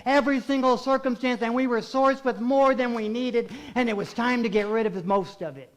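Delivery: loud, high, impassioned pulpit delivery; full-force preaching. Acted. Preacher urging congregation to give love away, full-force preaching.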